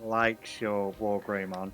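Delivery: normal talking voice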